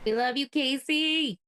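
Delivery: high, drawn-out sing-out of affection; affectionate call-out. Real, not acted. Host to Casey who is unwell, high affectionate call-out. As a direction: send love out to her, pitch lifted and slow. Calling out love to absent friend.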